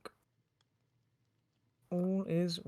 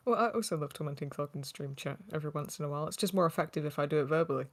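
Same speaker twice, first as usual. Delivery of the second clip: usual speaking voice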